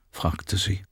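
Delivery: plain reading voice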